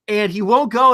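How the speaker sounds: normal talking voice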